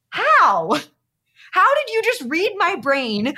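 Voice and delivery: high, loud astonished disbelief; amazed. Retold or imitated. Retreat goer stunned a stranger answered her question, astonished. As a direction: gasp out the wonder of it.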